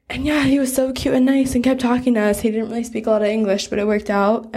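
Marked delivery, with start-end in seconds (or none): none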